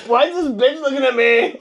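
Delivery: high yelled line through laughter; mock yell. Imagined yeller in the quiet library, laughing. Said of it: "quiet conversation with somebody".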